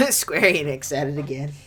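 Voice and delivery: usual speaking voice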